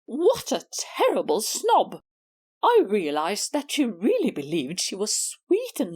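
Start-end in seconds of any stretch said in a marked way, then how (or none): none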